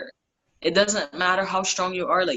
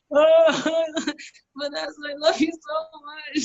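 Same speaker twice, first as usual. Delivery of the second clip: much higher, half-laughing, half-crying thanks; tearful gush; 10 semitones higher, 3 dB louder than usual. Retold or imitated. Thanking her friend on the High Line, higher and tearful. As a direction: gush like you're about to burst into tears.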